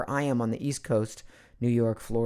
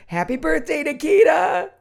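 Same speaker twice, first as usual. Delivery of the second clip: festive cheer; 8 dB louder than usual. Real, not acted. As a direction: shout a celebratory greeting, sustained high at the end. Cheering birthday greeting to daughter.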